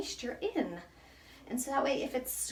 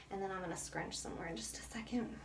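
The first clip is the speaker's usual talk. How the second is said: soft whisper; 3 semitones lower, 9 dB quieter than usual. Real, not acted. Gentle styling demo.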